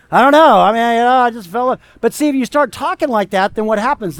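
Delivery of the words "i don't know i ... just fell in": mock bewildered shrug. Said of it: "it's just like".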